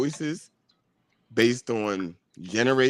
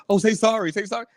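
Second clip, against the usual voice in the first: high, sing-song nagging; mocking boss voice; 10 semitones higher, 6 dB louder, faster than usual. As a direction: mimic a preachy manager. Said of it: "that's all they empathize and say".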